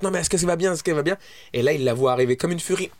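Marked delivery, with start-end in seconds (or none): 0.0-1.2 concerned bystander. Retold or imitated. Man at door questions him.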